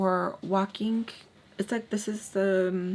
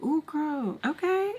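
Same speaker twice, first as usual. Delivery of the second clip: put-on high teasing ooh girl; high impressed ooh; 8 semitones higher than usual. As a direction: squeal it out, impressed. Guy impressed by her replies.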